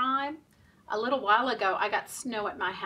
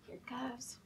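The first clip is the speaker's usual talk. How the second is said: hushed whisper; 12 dB quieter than usual. Real, not acted. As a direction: whisper it like a secret. Whispered aside in rhyme.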